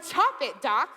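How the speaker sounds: high, loud mock insistence; defensive demand. Patient demanding amputation, loud hammed-up defensive demand. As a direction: order the doctor to get on with it. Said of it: "defensive that he's thinking".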